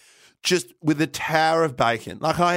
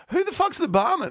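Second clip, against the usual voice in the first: much higher, incredulous mock question; mock bafflement; 14 semitones higher than usual. Audience puzzled by the unknown panellist, high mock bafflement. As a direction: squeal the question as a baffled onlooker.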